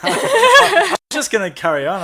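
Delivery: loud, high laughter into slow words; laughing. Real, not acted. Host to co-host who confused the Johns, laughing loudly. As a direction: laugh hard, then push on.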